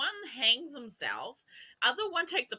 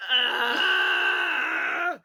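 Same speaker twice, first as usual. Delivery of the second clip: loud, higher frustrated shout; enraged yell; 8 semitones higher, 8 dB louder than usual. Retold or imitated. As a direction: let out a mock-furious cry. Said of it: "Like, enraged".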